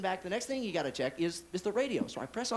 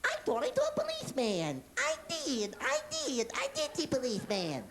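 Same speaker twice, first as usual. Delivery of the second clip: very high squeaky cartoon bird; Tweety bird voice; 9 semitones higher than usual. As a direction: chirp it like the cartoon canary. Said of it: "my car decides to tell me".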